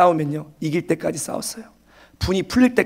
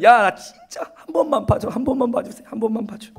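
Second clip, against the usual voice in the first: mock pleading; 5 semitones higher than usual. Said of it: "손을 막 빌면서 제발 막 간청한다는 거예요".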